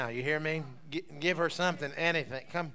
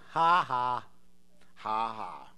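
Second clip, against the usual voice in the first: deliberate mocking laugh; 6 semitones lower, much slower than usual. Shown as a demonstration. Preacher scoffing at the devil, deliberate mocking laugh. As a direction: laugh slowly and pointedly at the devil. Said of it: "That's why I'm laughing at the devil".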